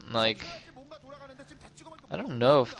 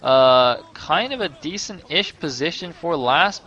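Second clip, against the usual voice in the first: usual speaking voice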